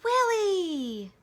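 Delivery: high, loud cry of the dog's name; boy calling to his dog; excited call. Acted.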